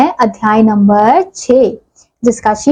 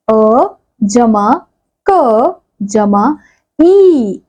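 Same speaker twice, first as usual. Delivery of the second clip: slow spelling out; much slower than usual. Shown as a demonstration. Teacher spelling out मटकी for the class, slowly. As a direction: spell it out piece by piece. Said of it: "अब अगला शब्द है".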